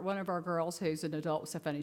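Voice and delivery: ordinary talk, nothing marked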